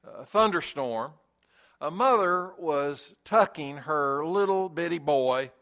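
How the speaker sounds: ordinary delivery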